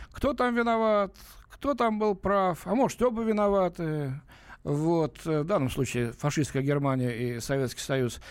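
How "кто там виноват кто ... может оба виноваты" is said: mocking. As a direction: parody the shrugging both-sides view. Host mocks the opposing view.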